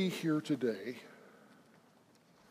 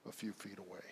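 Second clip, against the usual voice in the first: hushed climax; 7 semitones lower, 15 dB quieter, faster than usual. Acted. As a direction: drop right down to say it gently.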